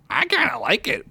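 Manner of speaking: squeaky, pleased little voice; high character voice. Acted. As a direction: say it like a chipper sidekick. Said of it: "Peppercorn said".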